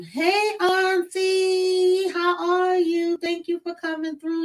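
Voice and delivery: high, slow, delighted hello to an auntie; happy greeting. Real, not acted. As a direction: greet a visiting aunt with real joy. Greeting aunt who arrives.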